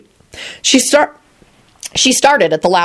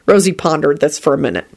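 ordinary delivery

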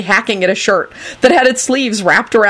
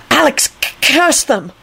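high, stammering gloom; gloomy; 8 semitones higher than usual. A narrator's reading. Melvin explaining to Dugan, gloomy. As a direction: inform gloomily, catching on the hard consonant. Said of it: "Melvin informed him gloomily".

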